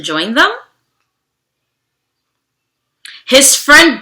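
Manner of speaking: steady storybook narration, slowing on key moments; reading to children. Acted. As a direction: read the story aloud to a class. Reads picture book to children.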